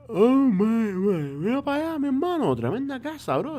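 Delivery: high, bright Miami Spanish accent. Real, not acted. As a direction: let the Miami accent slip out. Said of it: "my Miami accent pops out".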